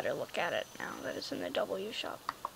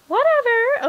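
dismissive; 12 semitones higher, 9 dB louder than usual. Real, not acted. Girl brushing off her friend tangent, high dismissive whatever. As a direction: sing out a loud brush-off.

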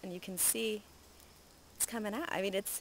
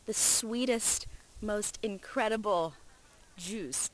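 savoring rave; 4 semitones higher, 4 dB louder, slower than usual. Real, not acted. Raving about passion fruit.

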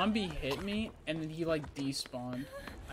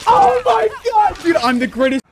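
loud, elated yell; triumphant shout; 17 dB louder than usual. Real, not acted. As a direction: shout it like you just won. Shouting after winning a chase.

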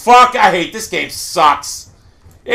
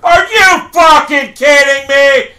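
slow, loud disbelieving yell; angry disbelief, loud; furious shout; 6 semitones higher, 4 dB louder, slower than usual. Real, not acted. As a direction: shout it at the screen. Another frustrating death.

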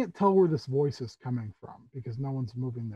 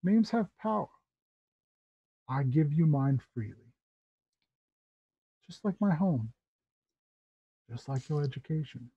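higher, lilting theatrical host, softening at the end; courtly Tabaxi uncle; 5 semitones higher than usual. Acted. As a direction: explain with grand, measured courtesy. Tabaxi host introduces himself.

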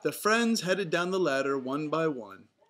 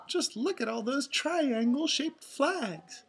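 high, slow cartoon exclamation; Daisy's amused voice; 10 semitones higher than usual. A narrator's reading. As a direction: marvel slowly in a high character voice. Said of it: "laughed Daisy".

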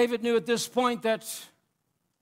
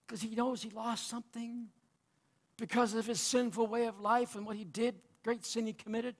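ordinary talk, nothing marked

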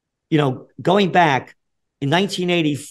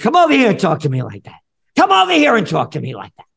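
loud, raised-pitch challenge; retold yelling; 7 semitones higher, 7 dB louder than usual. Yelling at a heckler outside the rally, retold yell. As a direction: re-enact yelling a challenge at someone. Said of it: "I'm yelling at the top of my lungs".

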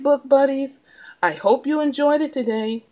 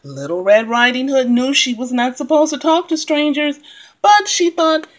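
ordinary delivery, nothing marked

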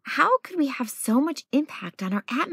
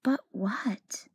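softer whispered question; suspenseful whisper; 4 dB quieter than usual. A narrator's reading. Storyteller teasing young listeners with a whispered question. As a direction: whisper it to leave them hanging.

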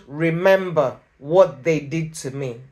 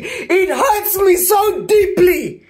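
anguished cry; 9 semitones higher, slower than usual. Real, not acted. Telling viewers how deeply the betrayal hurts, anguished slow cry. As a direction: let the pain break out. Describing deep hurt from betrayal.

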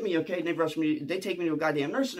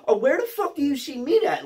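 high-pitched exasperated demand; freaking out; 7 semitones higher, 4 dB louder than usual. Retold or imitated. Inmate questions cellmate about the tray, freaking out. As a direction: demand an answer in baffled alarm. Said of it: "I'm freaking out".

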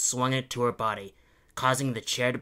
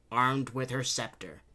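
plain reading voice

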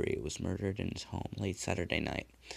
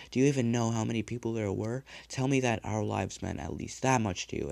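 loud, raised furious demand; raging demand; 4 semitones higher, 6 dB louder than usual. A narrator's reading. Dead man demands the killer count his victims, raging. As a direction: bear down on him in rage.

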